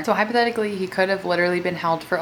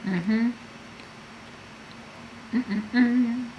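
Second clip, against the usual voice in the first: humming with a mouth full of chips; mm-hmm through a mouthful of chips; crunching chips; 5 semitones higher, 6 dB quieter than usual. Real, not acted. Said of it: "I keep having to grab this towel to wipe my hands off".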